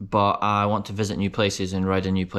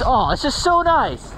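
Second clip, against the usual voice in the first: delighted; 13 semitones higher, 3 dB louder, slower than usual. Real, not acted. Savoring the trail.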